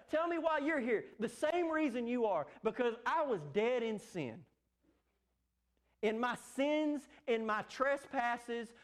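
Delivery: fervent, rising sermon cadence; impassioned pulpit delivery; preaching at full force. Acted. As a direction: drive it home to the congregation. Preaching builds with raised voice.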